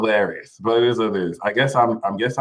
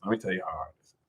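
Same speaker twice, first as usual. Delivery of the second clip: much softer, half to himself; quieter repeat; 5 semitones lower, 14 dB quieter, much faster than usual. Shown as a demonstration. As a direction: drop it to a murmur.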